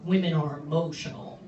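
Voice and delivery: low, dopey bro voice, mocking; mocking dude voice. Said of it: "some dudes will be like".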